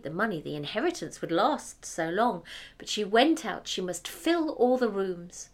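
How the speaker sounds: ordinary delivery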